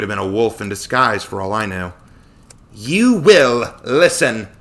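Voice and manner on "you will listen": very slow, loud, imperious order; commanding goddess. A narrator's reading. Artemis demands Joshua hear her, commanding. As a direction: stretch each word as a command.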